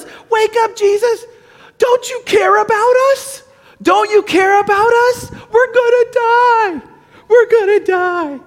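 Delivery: high-pitched desperate pleading; acted terror. Disciple waking Jesus in the storm, acted terror. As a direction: plead in hammed-up panic, wailing out each cry. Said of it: "They were terrified".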